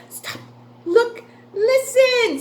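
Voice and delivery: hushed stop, then high loud look and listen; attention call. Shown as a demonstration.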